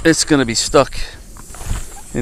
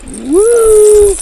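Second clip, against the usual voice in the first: woo. Real, not acted. Cheering after a struggle.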